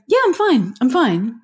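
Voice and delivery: fake-cheerful I'm fine; mock brush-off. Parent to child, a mock cheery brush-off. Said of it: "if we go".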